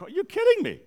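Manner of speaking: high, loud retold disbelief; incredulous. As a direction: exclaim it in mock outrage, voice leaping up. Retold disbelief at being yelled at.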